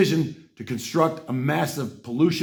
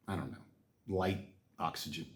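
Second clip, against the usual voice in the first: much softer and lower, half to self; muttered aside; 10 semitones lower, 16 dB quieter than usual. Real, not acted. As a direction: trail off searching for words. Trailing aside while groping for words.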